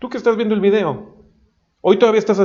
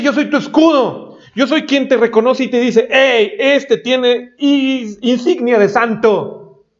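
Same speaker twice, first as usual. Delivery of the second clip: voicing God; 6 semitones higher, slower than usual. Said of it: "Pero Dios dice".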